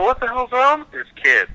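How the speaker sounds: high, exaggerated disbelief; a very high mock cry about the party drunk. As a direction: squeal at the drunk kid in mock horror. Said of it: "And I would go".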